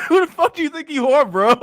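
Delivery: high, loud, laughing through the words; can barely get it out for laughing; laughing in disbelief. Real, not acted. To the chat about a corny intro, laughing hard.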